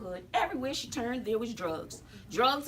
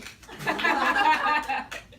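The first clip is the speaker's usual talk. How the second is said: laugh; 5 semitones higher, 5 dB louder than usual. Real, not acted. Laughing at student remark.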